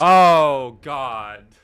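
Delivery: loud, drawn-out groan of dismay; long loud "oh", falling away; dismayed outcry. Real, not acted. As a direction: cry out in cringing dismay, then sink. Dismayed at AI's anime talk.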